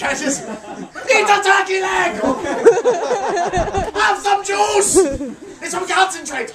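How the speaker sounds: slow barked orders, half laughing; hammed-up dictator yelling at the crowd; comic tyrant shouting orders at a Ren Fair; mock tyrant shouting. Imagining Hitler's Ren Fair.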